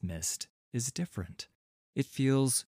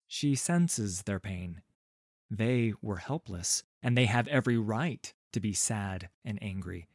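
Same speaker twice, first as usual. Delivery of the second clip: ordinary delivery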